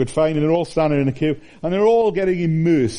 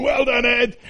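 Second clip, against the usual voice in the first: higher cheering shout; crowd cheer; 10 semitones higher than usual. Retold or imitated. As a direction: call it out like a proud fan at the touchline. Crowd shouting at rugby match.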